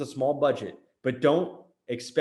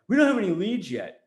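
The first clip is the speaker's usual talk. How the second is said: higher-pitched mimicked grumble; mock complaint; 5 semitones higher, 4 dB louder than usual. Marketer mimicking lazy guys with no leads, mock complaint.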